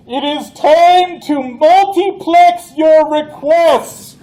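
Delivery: loud, high, slow commanding yell; alien yelling. Acted. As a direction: bark the order like an invading alien. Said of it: "they start yelling at you".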